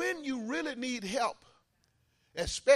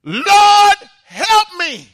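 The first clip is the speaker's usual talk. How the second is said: crying out; 11 semitones higher, 20 dB louder, much slower than usual. Acted.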